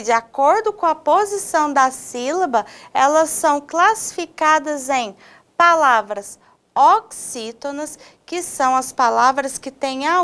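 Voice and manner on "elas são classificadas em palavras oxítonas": normal talking voice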